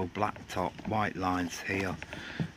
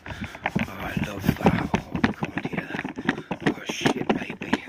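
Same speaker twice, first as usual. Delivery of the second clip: a hint of a hammed-up breathy moan; mock swoon, half voiced; breathy mock pleasure, not fully voiced; breathy put-on moan; 4 dB louder, slower than usual. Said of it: "that was a good one".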